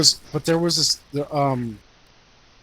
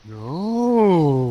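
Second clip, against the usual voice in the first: long, swooping no; drawn-out refusal; 4 dB louder than usual. Retold or imitated. Bouncer messing with a girl at the door, drawn-out refusal. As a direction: stretch the no out teasingly.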